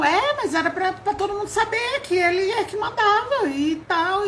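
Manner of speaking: high, whiny voicing of the other person; hammed-up whine of a naive listener; mock naive reply. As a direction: play her reply high and almost tearful.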